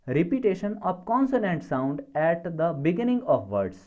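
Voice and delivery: very slow, word by word; spaced-out dictation read. Shown as a demonstration. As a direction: dictate it so students can write. Teacher dictating a definition.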